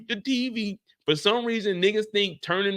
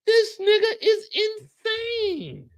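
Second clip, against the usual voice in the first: exasperated high squeal; 11 semitones higher, slower than usual. Acted. Exasperated high comic outburst.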